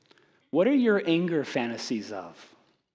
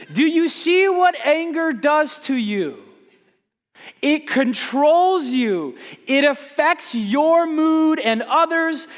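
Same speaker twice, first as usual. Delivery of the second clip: slow, loud, higher preaching; 10 semitones higher, 7 dB louder than usual. Acted. Pastor warns congregation about anger, slow forceful preaching. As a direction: drive the lesson home slowly.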